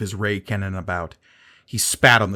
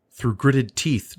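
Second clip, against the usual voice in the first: ordinary delivery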